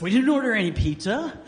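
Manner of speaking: loud, fast and high exasperated protest. Retold or imitated. Gordon to the pizza delivery, loud and exasperated. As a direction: blurt it out, fed up.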